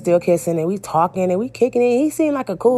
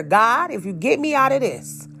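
higher-pitched bargaining with God; mock desperate prayer; 6 semitones higher than usual. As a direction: act out a pleading prayer, pitched up. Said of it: "you know that famous prayer".

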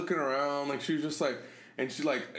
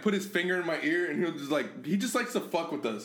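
ordinary delivery

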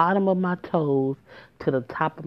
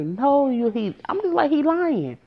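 high-pitched retold denial; indignant protest; 10 semitones higher than usual. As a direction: protest the lie, voice shooting up high. Retold protest against his lie.

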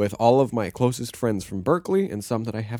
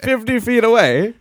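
mock emphatic; 11 semitones higher, 6 dB louder than usual. Real, not acted. Repeats the jab louder and slower.

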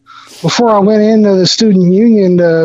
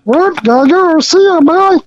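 high, chirpy little elf; squeaky holiday elf greeting; Christmas elf voice; 9 semitones higher than usual. Retold or imitated. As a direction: play a cheery Christmas elf. Elf flees.

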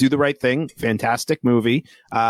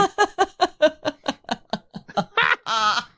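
giggling fit, way up high; high helpless laugh. Real, not acted.

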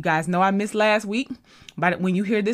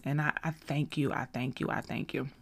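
fading softer with each thank you; sincere thanks; 3 semitones lower, 7 dB quieter than usual. Real, not acted. Host thanking her podcast listeners, soft and sincere. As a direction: let the thanks sink to a hush.

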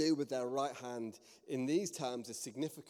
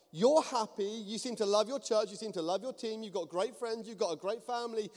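high, insistent listing; acting out someone worked up, voice raised; getting worked up; 7 semitones higher, 5 dB louder than usual. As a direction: press each point with rising exasperation. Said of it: "she's just getting worked up".

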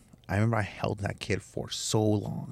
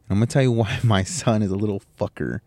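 ordinary delivery

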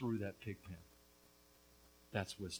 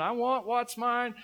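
insolent son demanding; 10 semitones higher, 9 dB louder, slower than usual. Retold or imitated. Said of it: "that insolent son is standing before his father going".

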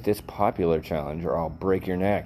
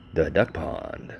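usual speaking voice

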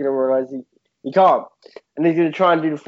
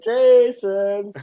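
mock calling; 6 semitones higher than usual. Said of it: "shout Jason for ages".

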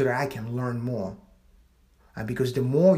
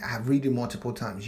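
usual speaking voice

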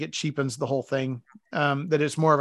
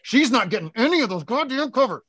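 mock divorce court demand; 5 semitones higher than usual. Imagined divorce court quote.

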